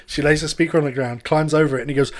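usual speaking voice

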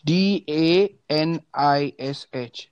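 slow, letter by letter; spelling it out. Shown as a demonstration. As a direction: spell each letter distinctly. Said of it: "spelling name out slowly".

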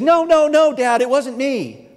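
high, loud mock girl's protest; playful daughter denial. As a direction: play the kid denying it. Daughter denying, voiced by dad.

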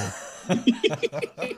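bursting into a high laugh. Real, not acted. Gamer laughing at his own park staff, high laugh.

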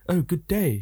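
high-pitched, overdone posh English. Retold or imitated. Brit as Americans imagine, greeting politely, posh English accent. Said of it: "Americans think that we all speak".

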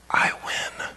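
whispered gloating, breathy and low. Retold or imitated. Husband gloats to his wife after signing, whispering. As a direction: lean in and breathe the win quietly.

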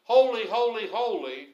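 loud ringing cry of holy; proclaiming holy at full voice; shouted praise. Retold or imitated. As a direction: call it out like angels in worship. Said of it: "as the angels are shouting".

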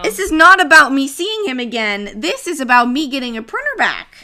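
loud, high, hammed-up refusal; mock defiant yell. Retelling her vow about the ex's printer, mock defiant shout. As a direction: shout it like a stubborn ex.

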